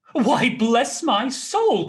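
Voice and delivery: high, loud, slow astonished exclamation; a startled nephew at the door; surprised cry. Acted. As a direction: cry out in amazement. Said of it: "cried Fred".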